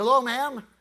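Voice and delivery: loud friendly call through the door. Retold or imitated. Preacher calling to a woman behind her door, loudly. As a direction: call out brightly to the woman inside.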